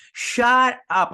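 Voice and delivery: high, loud, drawn-out exclamation; delighted disbelief. Retold or imitated. Fan seeing a favourite character return, delighted disbelief. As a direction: cry it out in thrilled disbelief.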